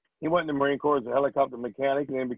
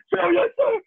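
very high, crying sir-yes-sir; panicked crying; much slower than usual. Retold or imitated. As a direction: cry it out in terror.

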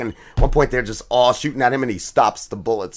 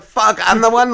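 freaking out; 10 semitones higher, 5 dB louder than usual. Retold or imitated. She realizes she caused death.

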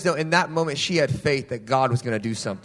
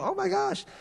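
very high squealed gasp; excited squeal; 4 dB quieter than usual. Retold or imitated. Greeting the group, a high squealed oh my gosh. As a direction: squeal it with delighted surprise.

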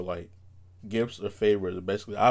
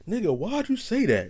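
incredulous; 11 semitones higher than usual. Retold or imitated. Friends' reaction quoted.

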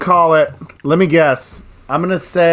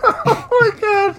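high, slow, wheezing laugh; gasping it out mid-laugh; laughing hard; slower than usual. Real, not acted.

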